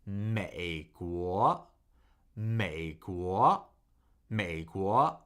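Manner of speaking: deep third-tone 美 climbing into second-tone 国; tone demonstration. Shown as a demonstration. Teacher drilling America for learners, 美国 low then rising. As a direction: dip 美 low, send 国 rising. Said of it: "Third tone, second tone".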